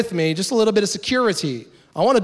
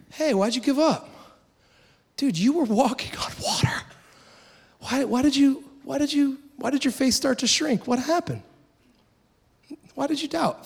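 higher, soft, kindly coaxing; gentle encouragement; 8 semitones higher, 4 dB quieter than usual. Retold or imitated. Jesus gently coaxing Peter, who sank, soft and high. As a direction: ease him back up like a friend. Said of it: "I don't think he's mocking them".

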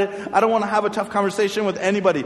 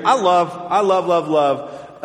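ordinary delivery

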